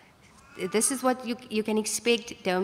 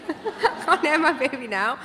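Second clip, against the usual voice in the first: higher laughing denial; in the nappy aisle, laughing at her own timing; laughing protest; 6 semitones higher, 4 dB louder than usual. Retold or imitated. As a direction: laugh it off in mock panic. Laughing, retelling contraction.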